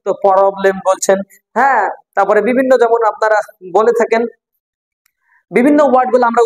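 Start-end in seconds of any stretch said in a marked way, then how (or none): none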